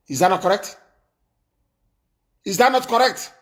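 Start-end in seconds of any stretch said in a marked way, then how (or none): 2.4-3.3 higher-pitched, pressing repeat of the question; insistent question. Real, not acted. Host challenging his listeners to agree, insistent.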